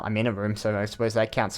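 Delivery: ordinary talk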